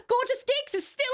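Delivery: high, put-on British exclaiming; British accent. Streamer thanking a viewer for the request, excited British accent. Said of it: "Thank you for the British accent".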